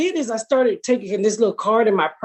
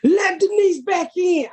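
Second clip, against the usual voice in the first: high, slow shouted line; mock fan yell; 10 semitones higher, slower than usual. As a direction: call it out like a heckling fan. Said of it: "yell out, lines from the show".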